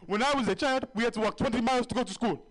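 high, loud hammed-up father's boast; mock dad lecture. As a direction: preach the old hardship like a dad. Dad's exaggerated hardship story.

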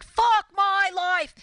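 high, slow, drawn-out wail; imitated neighbour's lament; mock despair. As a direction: moan it like a man at his wits' end. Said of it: "goes like".

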